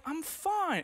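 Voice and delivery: high, bright denial of any trouble; chirpy fake fine. Pastor weighed down, pretending to the congregation he is okay. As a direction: sing it out as if all is well. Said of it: "I'm like".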